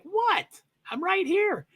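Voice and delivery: very high, squeaky confused-dog voice; squeaky bewildered pet impression; puzzled dog voice. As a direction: pitch it way up as a baffled pup. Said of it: "Is that the right voice inflection?".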